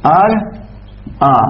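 أرأى split slowly in two with a long gap; drawn-out teaching demo of أرأى, syllable by syllable; broken into syllables. Shown as a demonstration. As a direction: say أرأى as "ar", pause, then "aa". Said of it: "شنو أصلها".

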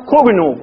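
"خرنوب" said xurnub, u after kha; damma on the kha. Shown as a demonstration. Teacher quotes eloquent usage, "خرنوب" said xurnub with damma. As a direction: round the opening vowel of "خرنوب" to u. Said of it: "والفصحى يضمونه يقولون".

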